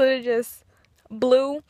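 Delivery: talking with finger in mouth; lip pulled down by finger. Real, not acted. Seen 0: Finger pulls lower lip down showing braces; again 3.8–5.3 s.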